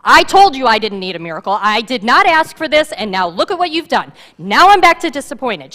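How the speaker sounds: grieving mother yelling at the prophet, angry; angry woman. Acted. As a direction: lash out at the prophet. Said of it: "She's angry".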